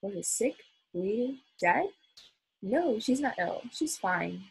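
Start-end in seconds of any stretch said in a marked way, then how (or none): none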